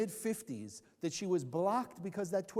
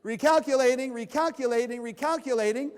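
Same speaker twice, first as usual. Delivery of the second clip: loud, higher robotic nagging repeat; GPS voice; 4 semitones higher, 9 dB louder than usual. Retold or imitated. Sermon teller mimics the GPS, loud nagging robotic voice. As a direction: mimic a nagging car navigator. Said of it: "what does the G P S say?".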